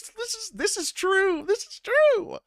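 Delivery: high, rising plea to be believed; high-voiced protest of honesty; high-pitched insistence. Real, not acted. Insisting the tale is true.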